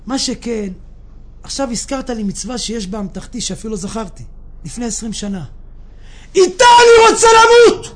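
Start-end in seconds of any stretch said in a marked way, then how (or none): 6.4-7.9 high, very loud desperate outcry; acted shout. Sick man clinging to his last good deed, desperate shout. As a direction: shout it at the top of your voice. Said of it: "הוא התחיל לצעוק".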